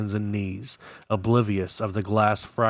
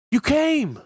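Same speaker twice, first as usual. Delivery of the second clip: very high, raised-voice cry; excited shout; 4 dB louder than usual. A narrator's reading. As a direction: shout it out, pitch soaring. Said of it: "Jake shouted".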